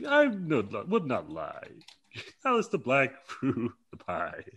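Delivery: lightly voiced Keith Lee, starting high, slow; grand slow denial in his promo style; Keith Lee impression. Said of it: "that's how he talks".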